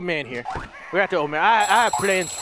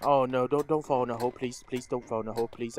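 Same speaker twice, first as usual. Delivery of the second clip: soft, low, anxious begging; hushed worried plea to the game; quiet nervous pleading; 8 semitones lower, 7 dB quieter than usual. Real, not acted. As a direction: murmur it, praying it goes well. Pleading with character, scared.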